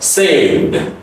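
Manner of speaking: 'saved' as sayvd; voiced D ending. Shown as a demonstration. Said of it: "sound like a erd".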